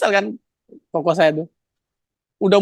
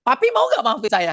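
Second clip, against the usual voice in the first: raised-pitch retold scolding tone; imitated snapping demand; 7 semitones higher than usual. Brother demanding his father's forgiveness, snappish retold demand. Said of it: "Ngomongnya bentak lagi".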